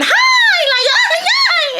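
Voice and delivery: squeaky high sing-song hi; hammed-up chirpy greeting; mock bubbly greeting. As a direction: overdo the cheerful coworker's hello, very high. Mocking coworker's overly cheerful greeting.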